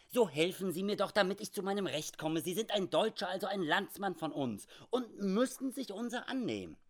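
high-pitched, insistent appeal; urgent pleading. Acted. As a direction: press a countryman for help, pitch climbing. Schmidt pleads for help.

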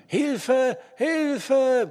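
high, loud, desperate call; drawn-out scream for help; bellowed cry for help. A narrator's reading. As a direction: cry out from under the rubble. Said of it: "brüllte es".

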